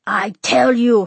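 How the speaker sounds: slow, loud and insistent; loud fatherly scolding; father's shout. A narrator's reading. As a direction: shout it as an angry father, stretching the words. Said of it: "her father shouted".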